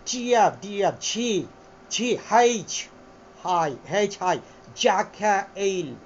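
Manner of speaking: letters slurred together in a British-American accent. Shown as a demonstration. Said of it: "আমি এটাকে যদি দ্রুত বলি".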